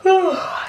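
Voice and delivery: big audible yawn. Real, not acted. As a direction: stretch out a long yawn. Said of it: "Sorry, I'm yawning".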